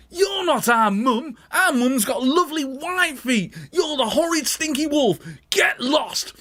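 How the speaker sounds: Billy shouting at the wolf; cried out. Acted. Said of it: "and he cried".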